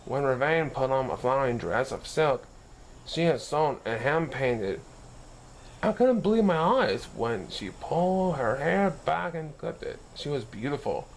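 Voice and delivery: plain reading voice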